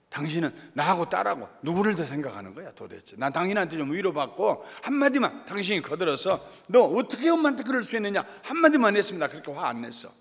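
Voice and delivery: loud, heated acted scolding; fuming wife. Wife turning on her silent husband after fighting their daughter, fuming. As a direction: nag and accuse, rising to an outburst. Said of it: "씩씩거리고 들어와서는 이제 이 차전으로 남편하고 싸우는".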